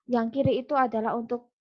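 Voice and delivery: ordinary delivery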